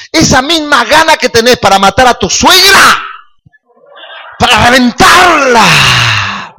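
rising to a full shout, the last words dragged out slowly; preaching shout. Real, not acted. Preacher shouting to the church about anger's force. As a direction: shout it out to the congregation.

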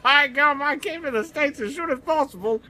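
high, mangled Australian-style delivery; goofy high-pitched pseudo-Australian; botched Australian accent. Acted. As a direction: keep up the terrible Aussie accent. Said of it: "kinda like an Australian with a stroke".

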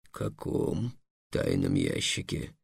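very low, slow, soft anxious question; the dragon suddenly uneasy; worried dragon. A narrator's reading. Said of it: "заволновался дракон". (встревоженный низкий медленный голос дракона)

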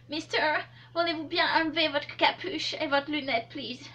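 put-on hairdresser's English accent on French. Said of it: "Et coiffeuse".

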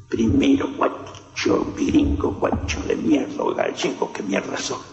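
very low, husky retold abuse; low growled insults, retold; imitated interrogator. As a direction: imitate a captor muttering insults, low and toneless. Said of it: "y el otro repetía".

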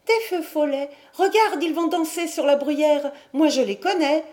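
put-on high, loud, girlish rapture; delighted. A narrator's reading. Mad sister spotting dancing lights, high and delighted. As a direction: cry out in delight, high-voiced. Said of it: "Yvonne semblait ravie".

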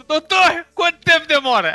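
eager patient. Retold or imitated. Patient asks dentist, retold.

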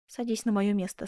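plain reading voice

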